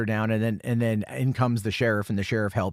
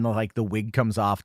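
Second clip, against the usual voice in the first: plain reading voice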